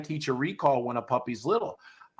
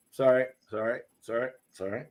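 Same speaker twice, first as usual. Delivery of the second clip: lower, fading sorries; apologetic dog; 4 semitones lower than usual. Retold or imitated. Trainer voicing a puppy correcting itself, lower and fading. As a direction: grovel and trail away. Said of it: "They'll go".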